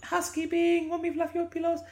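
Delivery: high squeaky housekeeper call; mock housekeeper. Host playing a housekeeper offering pillow fluffing, high silly voice. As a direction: put on a silly, high-pitched maid voice.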